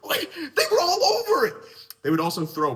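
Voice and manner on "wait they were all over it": high, louder protest breaking into laughter; laughing protest. Retold or imitated. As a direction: blurt it out, laughing. Boy protests to the teacher.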